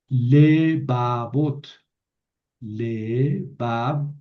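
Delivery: lebabot spelled out very slowly, syllable by syllable; slow Hebrew word. Shown as a demonstration. Teacher modeling lebabot for the class, very slow. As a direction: drag out lebabot so students can copy it. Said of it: "Ya no es lebabot, sino lebabot".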